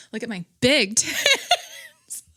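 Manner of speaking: slow, laughing through the words. Real, not acted. Vlogger joking about herself, laughing through it. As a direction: crack up mid-line.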